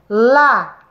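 "La-go-a" in three separate beats; syllable by syllable. Shown as a demonstration. Teacher to pupils, counting syllables, La-go-a split apart. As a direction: clap out "La-go-a" one syllable at a time. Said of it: "Três pedacinhos".

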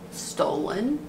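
ordinary talk, nothing marked

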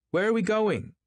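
high, loud woman's call; crying out. A narrator's reading. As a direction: call it out, pitched right up. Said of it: "she cried".

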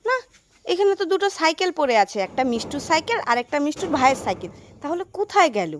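usual speaking voice

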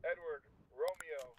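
ordinary talk

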